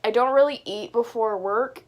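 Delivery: ordinary talk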